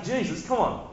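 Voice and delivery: high, sneering taunt; mock him from below the cross; mocking ruler. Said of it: "They're saying".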